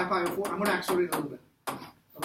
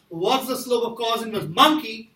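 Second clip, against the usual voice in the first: "monkey" punched louder at the end of the question; stress on monkey; 2 semitones higher, 5 dB louder than usual. Shown as a demonstration. Teacher repeats the question she asked the class, stress on monkey. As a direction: lean hard on the word "monkey". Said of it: "I say emphasize the word monkey".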